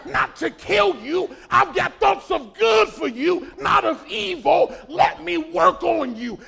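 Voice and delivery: slow, loud divine urging; booming plea from God. Retold or imitated. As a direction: plead slowly and loud as God. God voiced, pleading.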